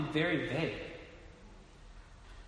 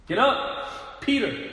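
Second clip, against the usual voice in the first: loud, high call from heaven; a commanding heavenly shout; divine voice crying out; 7 semitones higher, 7 dB louder, much slower than usual. Acted. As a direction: cry it out like a voice from the sky. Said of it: "a voice cries out".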